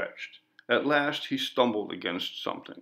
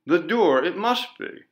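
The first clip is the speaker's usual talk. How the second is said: louder, higher hopeful cry; excited; 6 semitones higher, 5 dB louder than usual. A narrator's reading. Man finding the way out, louder and excited. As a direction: leap at the hope. Finds an opening in the wall.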